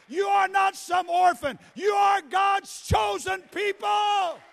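loud sermon building to a high, slow shout; full-force preaching. Real, not acted. Preacher telling congregation they are chosen, full-force preaching. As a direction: shout it over the congregation, stretching the climax.

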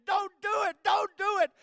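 high, loud retold pleading shout; crying plea. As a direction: beg him desperately, like a crying brother. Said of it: "he started crying".

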